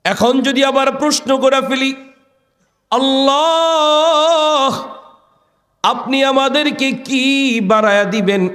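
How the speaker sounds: high-pitched sermon chant; preacher's ringing high call; impassioned preaching. Acted. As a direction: lift it high and draw out the key words.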